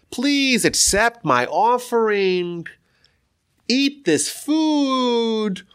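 high, very slow imploring plea; stretched-out, high childlike entreaty; innocent pleading prayer. Retold or imitated. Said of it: "he's crying very innocently".

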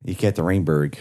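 Americanized Yekaterinburg, the American way; American accent. Shown as a demonstration. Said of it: "Can you say that with an American accent?".

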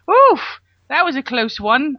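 high, loud "oof", then easing off; exaggerated mock relief after a near miss; relieved survivor. Imitating the actor surfacing after the shark, mock relieved. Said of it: "coming up going".

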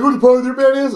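high-pitched drunk imitation; drunk heckler. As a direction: repeat it like a pushy drunk. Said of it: "Just drunk as shit".

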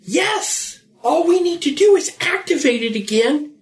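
high-pitched, eager announcement of a discovery; excited revelation. Acted. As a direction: pitch it way up, thrilled by the find. Breathless news of a way out.

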